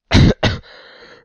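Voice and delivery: harsh, real chesty cough; coughing fit. Real, not acted. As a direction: cough hard into the mic. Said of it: "sorry about that".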